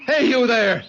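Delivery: high, carrying call. Acted. George calling to the man with the whip. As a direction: shout across to strangers.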